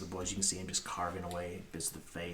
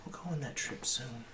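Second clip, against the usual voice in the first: put-on higher child voice promising mommy; little boy; 6 semitones higher than usual. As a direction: lift the pitch for a reassuring boy. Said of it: "He goes".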